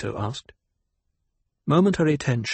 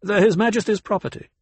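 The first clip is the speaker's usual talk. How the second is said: quick, curt retort in a higher voice; high-pitched, brisk rebuff; 9 semitones higher, 4 dB louder, faster than usual. A narrator's reading. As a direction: answer curtly, raised and fast. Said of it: "Kynes spoke curtly".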